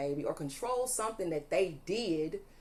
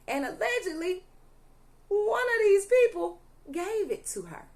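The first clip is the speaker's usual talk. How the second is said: outraged outburst; 11 semitones higher, 5 dB louder than usual. Real, not acted. Tarot reader to viewers, naming who gave the baby drugs, outraged outburst. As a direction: voice shoots up high and loud on the accusation.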